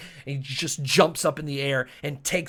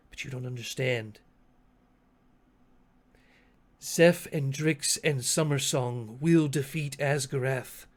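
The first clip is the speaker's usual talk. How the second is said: deliberate, measured prophecy with long pauses; lightly voiced, grave and resolved, slowing down; solemn leader in character. Asteri foretells victory to Chotuku, solemn.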